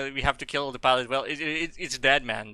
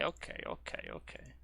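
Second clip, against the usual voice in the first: unvoiced, much softer okays; whisper; 15 dB quieter than usual. Real, not acted. Gamer whispering okay to himself. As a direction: whisper it to yourself.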